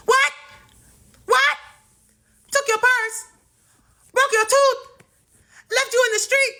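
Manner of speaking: very high-pitched mock shriek of disbelief; shocked outcry. Mom repeating the aunt's news on the phone, shocked outcry. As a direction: cry out each question in high alarm.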